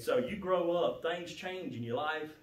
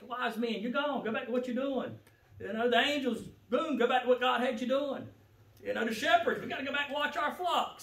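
ordinary delivery

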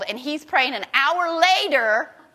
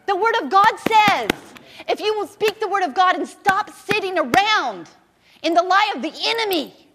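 loud, high, fast preaching; fiery preaching; 7 semitones higher, 5 dB louder than usual. Acted. Preacher thundering at the church about healing, loud and high. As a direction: preach it hard at the congregation.

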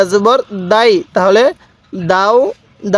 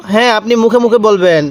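normal talking voice

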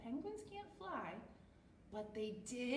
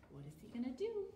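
ordinary delivery

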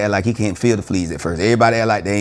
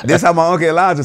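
Uncle Elijah voice; 8 semitones higher, 4 dB louder than usual. Retold or imitated. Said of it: "I sound like an Uncle Elijah".

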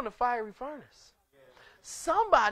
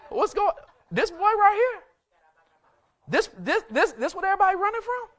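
higher, laughing disbelief at the giant; mock-puzzled David; 7 semitones higher, 4 dB louder than usual. David asking the soldiers why they run, mocking disbelief. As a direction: scoff at what scares everyone. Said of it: "David mocking fear of Goliath".

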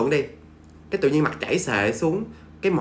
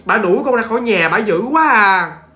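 mock lament; 4 semitones higher than usual. Possessed girl voicing the ghost, pleading to the aunts. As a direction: cry out to the aunts for pity. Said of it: "ổng kể cho bà Bảy".

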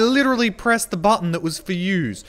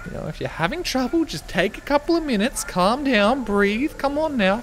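higher, softer motherly coaxing; coaxing mum; 4 semitones higher, 4 dB quieter than usual. Acted. Streamer playing a mum calming a child, coaxing. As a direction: soothe them like a patient mum. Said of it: "Why do you sound like my mum?".

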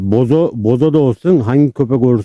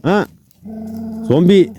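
surprised call; 9 semitones higher than usual. Real, not acted. Calling out to odd dog.

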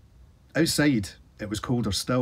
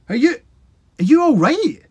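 loud, much higher, worried; anxious cry; 10 dB louder than usual. A narrator's reading. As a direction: cry out in relief and worry. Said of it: "Sid cried".

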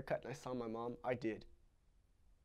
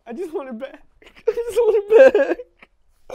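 breaking into sobs, high and loud; sobbing; 16 dB louder than usual. Acted. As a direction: cry it out, voice cracking. Child sobbing for mother.

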